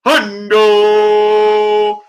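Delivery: loud, high, long-held "hundo"; drawn-out hype call. Pretend. Streamer celebrating a five hundred super chat, drawn-out hype call. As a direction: belt out the amount like an announcer.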